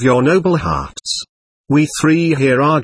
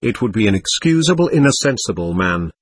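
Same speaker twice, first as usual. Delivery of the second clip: ordinary delivery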